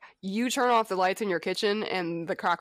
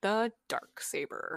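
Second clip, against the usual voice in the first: slow, drawn-out, portentous naming; very slow, weighty emphasis; dramatic reveal; 4 dB quieter, much slower than usual. Acted. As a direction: announce a legendary weapon with mock gravity. Echoes dramatic reveal of legendary weapon.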